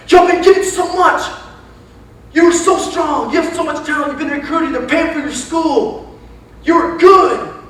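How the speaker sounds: high, intense hollering; freaked-out coach yelling. Retold or imitated. Crazy new coach hyping up his players, hollering. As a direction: holler it like an over-the-top coach. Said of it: "He would just, like, freak us out".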